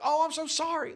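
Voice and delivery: high, lightly put-on apologetic lady voice; a mild mock of a fake-sorry driver; mimicking the lady. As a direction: friend imitates the driver who hit him, apologizing. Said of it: "she's like".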